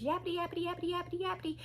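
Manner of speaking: high, soft singsong drone; distant yappity chatter. Retold or imitated. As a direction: mimic chatter heard from down the hallway. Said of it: "you could hear her in the hallway".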